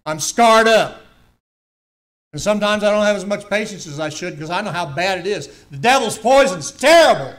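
high, loud preaching shout; fervent warning. Real, not acted. Preacher warning the children about scars, shouting.

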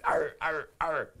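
playful growling arr sounds; gnashing growls. Acted. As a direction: growl like chomping monsters. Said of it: "and gnashed their terrible teeth".

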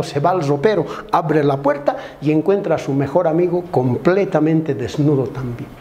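ordinary talk

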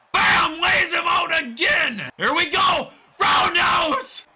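high, shouted move calls; hyped wrestling announcer, stretching the calls; excited play-by-play. Real, not acted. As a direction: yell each hit as it lands. Calling big moves in match.

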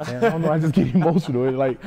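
a sheepish laugh breaking into the words. Retold or imitated. Fighter replaying his answer to coach, laughing. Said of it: "I'm like".

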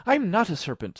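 high, loud indignant protest; offended little-girl denial. A narrator's reading. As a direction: object hotly as an offended girl. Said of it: "said Alice indignantly".

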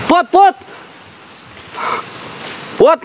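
very high, urgent questioning call; alarmed calling. Real, not acted. Calling to friend who screamed.

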